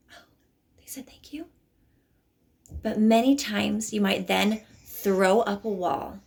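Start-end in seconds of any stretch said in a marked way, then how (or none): 0.1-1.5 hushed, unvoiced mock surprise; whispered disbelief. Viewer reacting to the bully's thanks, whispered disbelief. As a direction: whisper it like you can't believe it.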